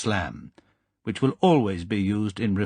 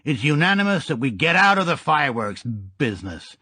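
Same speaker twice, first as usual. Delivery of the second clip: gangster voice; 2 semitones higher, 3 dB louder than usual. Acted. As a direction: play the grinning old Chicago gangster. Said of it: "like a ventriloquist's dummy".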